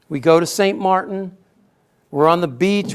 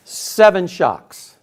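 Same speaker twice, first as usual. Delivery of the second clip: usual speaking voice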